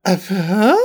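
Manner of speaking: mock confused grunt; puzzled little noise, questioning at the end; wordless bafflement. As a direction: imitate a man lost for words. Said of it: "doesn't really have any words either".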